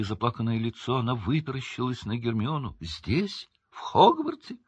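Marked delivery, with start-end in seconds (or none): 2.8-4.6 squeaky, rising disbelief; astonished. A narrator's reading. Winky learning her master is at Hogwarts, astonished. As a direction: leap up high on the second question.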